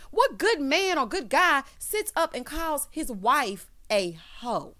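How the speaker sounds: slow, emphatic rhetorical question; indignant disbelief. Real, not acted. Host disputing that he's a good guy, slow and indignant. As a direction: start high and space it out, dropping on the end.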